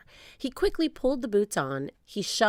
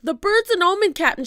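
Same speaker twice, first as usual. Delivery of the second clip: high, loud pirate shout; alarmed shout; 13 semitones higher, 8 dB louder than usual. A narrator's reading. Stinky warning the captain about the bird, shouting. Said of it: "shouted Stinky".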